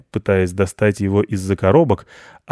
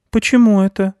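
surprised; 11 semitones higher, 4 dB louder than usual. Acted. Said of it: "удивился мальчик".

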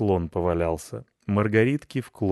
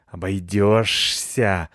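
louder, very drawn-out hiss; hissed refusal; 5 dB louder, much slower than usual. A narrator's reading. Cat rejecting his rival as chief, hissing. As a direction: stretch it out in spiteful refusal. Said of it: "зашипел Рыжопиус".

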